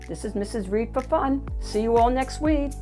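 ordinary delivery, nothing marked

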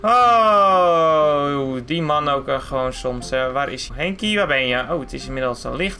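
low, weary muttering voice; deep-voiced owner, fed up; exasperated car owner. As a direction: grumble to yourself in a deep voice. Car owner looks for Henkie.

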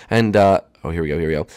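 usual speaking voice

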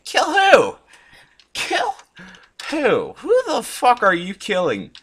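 loud, high incredulous yelling; blurted, high-pitched outraged questions; shocked disbelief. Real, not acted. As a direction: shout it back in alarm. Shocked by bot wanting to kill.

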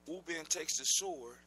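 ordinary delivery

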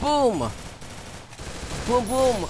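high, punchy boom sound effect; mouth gunshot boom. Pretend. Streamer mimics his shot at the enemy, mouth boom. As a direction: pop off a mock blast with the voice.

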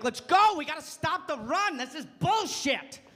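buddies-on-the-couch football shout; macho sports yelling. Retold or imitated. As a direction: yell at the game with the guys. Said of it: "I'd be watching a football game with my buddies and be like".

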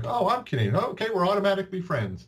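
excited self-quote. Retold or imitated. Retelling his excited reply.

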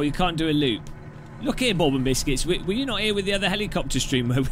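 ordinary delivery

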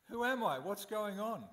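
high, loud, lost and frightened call; bewildered cry. Acted. Newborn Brahma calling out alone in the darkness, bewildered and loud. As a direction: cry out into the dark, not knowing where you are.

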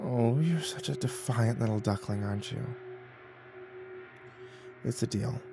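soft, teasing seductive moan; hushed, indulgent temptress. A narrator's reading. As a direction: purr it quietly to a reluctant lover. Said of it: "The succubus moaned".

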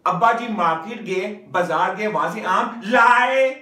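loud example sentence peaking on लाए; stress on लाए. Shown as a demonstration. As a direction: punch the final लाए high, loud and long. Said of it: "किस पे emphasise किया हुआ है".

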